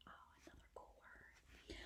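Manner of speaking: breathy whispered aside to the listener. Real, not acted. Narrator whispering an aside to the young listeners. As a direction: lean in and whisper it like a secret.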